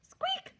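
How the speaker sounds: very high, startled mouse squeak; panicked little squeak; alarmed squeak. Acted. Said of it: "Mister Mouse yelled".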